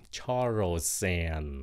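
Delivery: Charles and run together, the s carried into and, slow; linked s into and. Shown as a demonstration. Teacher shows slowly how the s links into and. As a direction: glide the s straight into and. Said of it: "âm xì nó bắt qua cái âm and".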